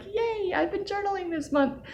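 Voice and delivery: high-pitched little celebratory squeal; playful high "yay" of self-congratulation; gleeful cheer. Retold or imitated. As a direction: cheer for yourself in a tiny, very high voice. Quotes own cheer over filled journal.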